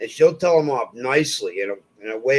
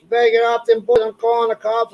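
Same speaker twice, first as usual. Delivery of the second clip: higher mock scolding old lady; 7 semitones higher than usual. Old lady threatening a kid with the cops, mock scolding. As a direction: mimic a nagging old neighbor, pitched up. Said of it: "and she's like".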